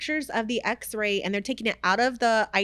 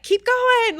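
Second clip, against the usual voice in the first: high, loud push to keep going; urging myself on; 14 semitones higher, 6 dB louder than usual. Retold or imitated. To herself while pushing the fridge, loud urging. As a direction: spur yourself on, loud and high. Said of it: "I'm just like".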